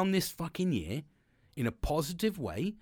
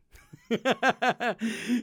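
loud laugh; 8 semitones higher, 6 dB louder than usual. Real, not acted. Laughing at own story.

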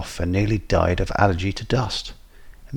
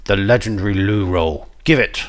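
forceful, louder threat at the end; snarling villain; 4 dB louder than usual. A narrator's reading. Robed man holds a knife to a throat, snarling demand. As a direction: demand it like a menacing robber. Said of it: "he snarled".

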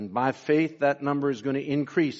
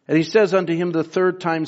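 usual speaking voice

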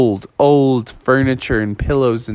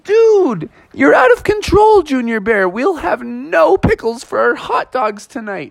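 exasperated Bubby; 10 semitones higher than usual. Acted. Bubby scolding Junior Bear, high and fed up. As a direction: complain at the little bear. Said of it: "Bubby was like".

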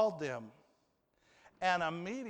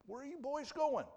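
put-on higher, indignant father calling after his boys; startled father; 7 semitones higher, 3 dB quieter than usual. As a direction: play the father left alone on the boat, higher. Father left behind by sons.

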